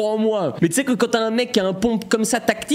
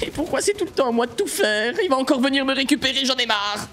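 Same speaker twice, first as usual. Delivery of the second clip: higher-pitched fed-up moan; whiny exasperation; 6 semitones higher than usual. Real, not acted. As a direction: complain like it is all unfair. Joueur se plaint de tout faire. (plainte aiguë et excédée)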